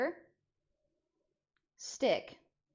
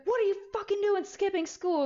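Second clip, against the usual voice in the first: much higher, loud scolding; angry mom; 12 semitones higher, 4 dB louder than usual. Retold or imitated. Mom scolding kid for skipping, loud high scold. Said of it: "And she's like".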